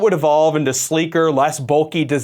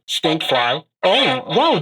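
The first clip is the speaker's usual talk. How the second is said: voice changer; 3 semitones higher, much slower than usual. Shown as a demonstration. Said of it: "that sounds just like Stinkfly".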